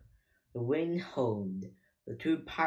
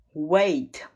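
loud, higher-pitched call; crying out; 4 semitones higher, 9 dB louder than usual. A narrator's reading. Pirate captain calling to his crew, crying out. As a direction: cry out to stop them. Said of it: "cried Captain Bone".

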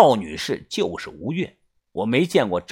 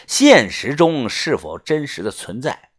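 plain reading voice

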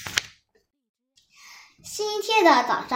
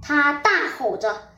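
ordinary delivery

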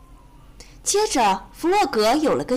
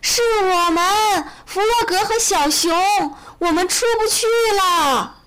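calling for help; 7 semitones higher, 4 dB louder than usual. A narrator's reading. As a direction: holler up to the rescuers. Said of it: "一起叫起来". (高声呼救)

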